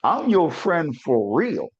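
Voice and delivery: high, drawn-out preacher's cadence; preaching exhortation. Real, not acted. Co-host urging courage to confront a friend, preaching it high and slow. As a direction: stretch key words and leave weighty pauses.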